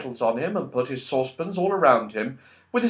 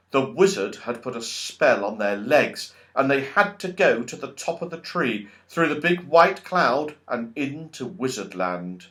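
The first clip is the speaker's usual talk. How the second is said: ordinary delivery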